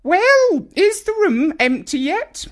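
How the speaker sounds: put-on very high, loud old woman calling upstairs; Grandma calling out. A narrator's reading. Grandma calling up to Nigel, very high and loud. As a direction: call up the stairs impatiently. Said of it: "Grandma called out".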